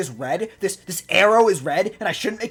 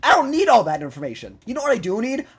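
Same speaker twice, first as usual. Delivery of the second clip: loud angry rant; 2 semitones higher, 7 dB louder than usual. Real, not acted. Continuing rant at drivers.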